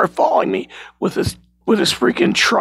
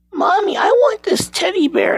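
put-on little boy voice; 11 semitones higher than usual. Little boy asks for toy.

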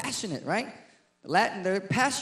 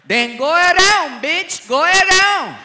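sassy shout; 7 semitones higher, 9 dB louder, slower than usual. Retold or imitated. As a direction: yell at the guy to walk around her. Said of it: "A Latin girl could be eleven hundred pounds".

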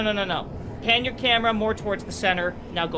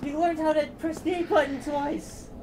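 high, slow, patronizing address to viewers; mocking; 7 semitones higher, 4 dB quieter, slower than usual. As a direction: talk down like praising a toddler. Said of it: "are you making fun of your father".

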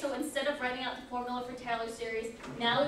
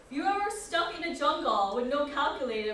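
lightly put-on Polish accent, louder; 3 semitones higher, 5 dB louder than usual. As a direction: quote the professor in a Polish accent. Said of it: "That was a really bad accent".

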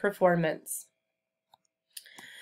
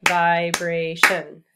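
"Vibration" slow and loud, syllable by syllable; loud, stretched syllable breakdown of "Vibration"; clapped syllables; 6 dB louder, much slower than usual. Shown as a demonstration. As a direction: beat out each syllable of "Vibration". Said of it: "Let's clap the syllables in vibration".

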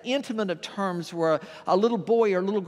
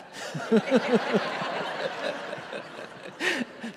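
amused little laugh; 5 semitones higher than usual. Real, not acted. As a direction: chuckle as the story slips away. Teller laughs at his own story.

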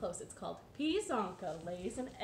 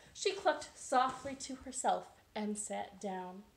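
ordinary delivery, nothing marked

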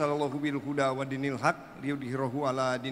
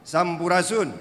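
called-out Sundanese greeting, higher; loud greeting call; 4 semitones higher, 6 dB louder, slower than usual. Real, not acted. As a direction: call out to the hall. Speaker calls greeting to crowd. (salam yang diserukan lantang)

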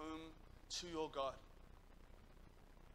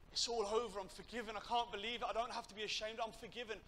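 higher, pouring out in disbelief; retold burst of emotional relief, pitched up; overwhelmed relief; 6 semitones higher, 4 dB louder than usual. Weeping lad realises he is forgiven, overwhelmed.

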